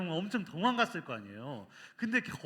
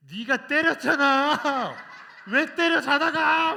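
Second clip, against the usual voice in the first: much higher, much louder, a slow indignant shout; angry wife; 9 semitones higher, 13 dB louder, slower than usual. Retold or imitated. Wife struck in her sleep, angrily shouting at her husband. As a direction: shout it at him, outraged. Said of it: "막 이렇게 막 화를 냈어요".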